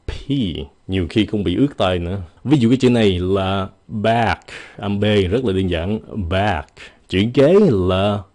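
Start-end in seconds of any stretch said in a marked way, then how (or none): none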